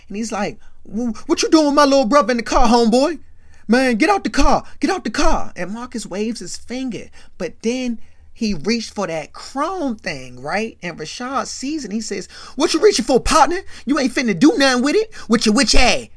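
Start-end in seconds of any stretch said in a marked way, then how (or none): 0.8-5.5 much higher, louder fast yelling; angry shout. Retold or imitated. Big brother yelling at Marcus to get out of the car, furious. 12.5-16.1 much higher, louder fast yelling; angry shout. Retold or imitated. Big brother yelling at Marcus to get out of the car, furious. Rashad faces Marcus reaching for gun.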